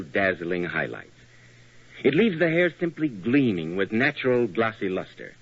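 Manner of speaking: radio ad read. Acted. Announcer continues the shampoo ad.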